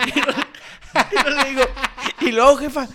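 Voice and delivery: laughing through the words; can't stop laughing while talking. Real, not acted. Laughing while retelling mom's story.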